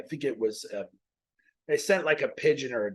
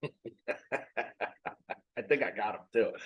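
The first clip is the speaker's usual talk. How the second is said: laughing; 6 dB quieter, much slower than usual. Real, not acted. Laughs after swatting a fly.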